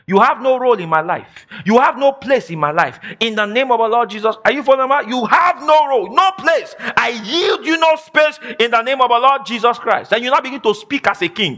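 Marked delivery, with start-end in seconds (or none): 0.1-4.4 firm, emphatic declaration of authority; demo declaration. Shown as a demonstration. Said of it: "You are talking like that". 5.2-10.1 forceful preaching. Acted. Preaching declaration at full force.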